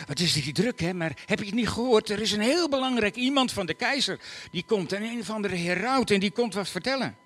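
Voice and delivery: lightly voiced excited boys. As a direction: tell the news breathlessly. Boys tell Joseph excitedly.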